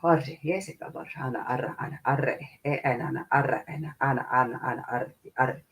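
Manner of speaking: soft stream of rolled invented syllables; light language. Real, not acted. Channelled light language chant.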